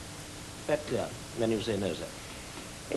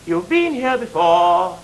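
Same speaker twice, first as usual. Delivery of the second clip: slow, deliberate "You've been here before."; "You've been here before." spoken slowly, leaving a pause for her nod; slow demo; 3 semitones higher, slower than usual. Shown as a demonstration. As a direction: draw it out, leave room for her nod. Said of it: "let her get her nod in first".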